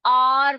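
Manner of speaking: long, emphatic आर with no h; आर as a stretched "aar", h silent; correct pronunciation. Shown as a demonstration. Teacher answering own question for pupils, correct pronunciation. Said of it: "क्या पढ़ेंगे?".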